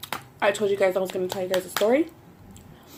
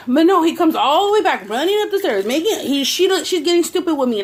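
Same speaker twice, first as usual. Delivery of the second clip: higher, louder, fired-up telling; heated retelling; 7 semitones higher, 5 dB louder than usual. Real, not acted. Complaining to viewers about him, heated and louder. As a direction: build up the outrage.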